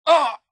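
loud high yelp of shock; startled yell. Acted. Barry startled by the trap, loud yelp. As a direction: jolt out a cry.

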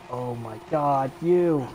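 real frustrated outburst; angry yelling. Real, not acted. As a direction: chew out the bastards. Yelling at swarming enemies.

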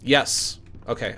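normal talking voice

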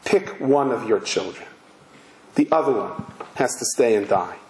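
low, unhurried imitation of a sweetly cruel officer; lightly acted, chillingly polite menace; mock sweetness. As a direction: say it low, as if through a sweet smile. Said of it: "with the most sweetest smile".

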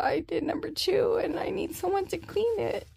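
fading pleading rasp; asking the nurse for help, voice worn out; raspy plea. Retold or imitated.

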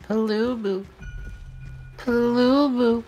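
high, slow drawn-out call. Real, not acted. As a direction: call the pet name, stretched. Calling for pet.

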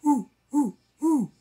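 very high, loud hooting voice; hooting owl. A narrator's reading. Owl hooting a question to the fawn. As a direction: hoot the words like an owl.

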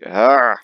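short, loud exasperated "ah"; frustrated cry. Real, not acted. Solo card player hitting a dead end, frustrated cry. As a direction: burst of frustration.

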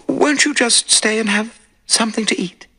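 a hint of a nervous widow; anxious widow. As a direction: play the uneasy lady of the house. Widow's invitation.

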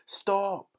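high, loud cry to halt; urgent command. A narrator's reading. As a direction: cut in sharply to stop someone. Wonka urgently halts the girl chewing.

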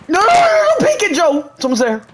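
high loud panicked yelling; panicked shout. Real, not acted. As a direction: yell the warning in panic. Panicked callout to teammate.

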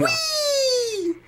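very high, long squealed "whee"; gleeful whee. Real, not acted. Player flung around in the game, high playful squeal. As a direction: squeal it like riding a slide.

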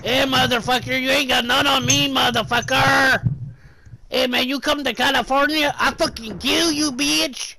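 high, loud mock tough-guy tirade; angry threatening rant. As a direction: yell hammed-up threats, drawing out the last words. Acted threats as the California man.

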